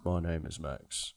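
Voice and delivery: low, grumbly, quiet mumble; Tom Hardy. Acted. Impersonating an actor hiding his accent, low grumble. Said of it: "He speaks very low to try and hide it".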